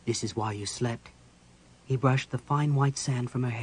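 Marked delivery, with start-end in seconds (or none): 0.0-1.1 quiet explanation. Acted.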